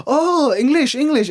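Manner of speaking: high, lightly imitated foreign man; a hint of sudden understanding; mock realization. Man at media event realizing, lightly mocked. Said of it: "He goes".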